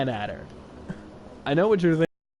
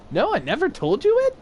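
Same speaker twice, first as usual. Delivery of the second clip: surprised; 4 dB louder, faster than usual. Real, not acted. Surprised at friend.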